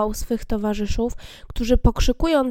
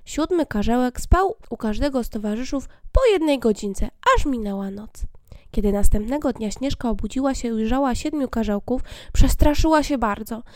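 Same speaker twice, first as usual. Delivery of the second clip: ordinary delivery, nothing marked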